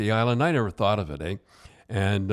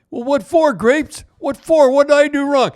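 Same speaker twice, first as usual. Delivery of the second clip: much higher, louder pleading protest; young player protesting; 10 dB louder than usual. Retold or imitated. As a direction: squeak out the kid's upset plea. Excited player protesting, voiced by coach.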